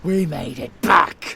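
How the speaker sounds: loud triumphant cheer; triumphant shout. Acted. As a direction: crow in triumph. Villain triumphant on arrival.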